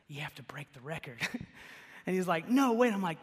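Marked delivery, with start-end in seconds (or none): 0.1-1.2 hushed, secretive whisper of a dare. Retold or imitated. Egging on friend Kyle to jump, whispered. 2.5-2.9 a mock shout of disbelief; loud refusal. Friend Kyle balking at the dare, mock shout.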